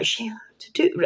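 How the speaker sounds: fast unvoiced whisper to a friend; a secretive, disbelieving whisper; whispered aside. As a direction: hiss it quickly so she can't hear. Stunned aside about the cashier.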